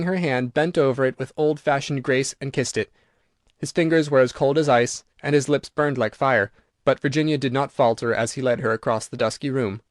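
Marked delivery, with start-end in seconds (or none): none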